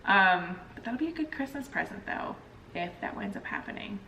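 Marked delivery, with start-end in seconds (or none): none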